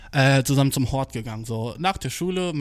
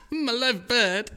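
slow, higher, hammed-up pitiful whine; broken-English mock whimper, slow and high; mock sulk; 9 semitones higher, much slower than usual. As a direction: overplay the sad sack, drawn out.

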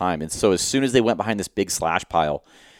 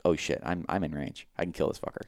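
hushed whisper; 3 semitones lower, 11 dB quieter than usual. Retold or imitated. Retold hunter's thought in range.

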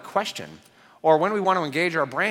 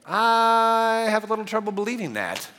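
higher, skeptical hammed-up doubt; doubtful Thomas; 7 semitones higher than usual. Thomas skeptically doubting the disciples' news. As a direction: play it like an unconvinced friend.

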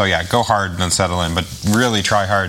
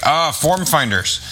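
put-on Norwegian accent; 7 semitones higher, slower than usual. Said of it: "terrible Norwegian accent".

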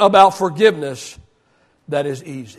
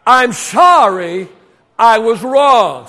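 slow call and response; 2 semitones lower, slower than usual. Shown as a demonstration. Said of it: "I want you to say that with me".

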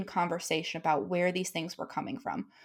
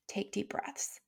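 soft whisper; 12 dB quieter, slower than usual. Retold or imitated. Calming her son.

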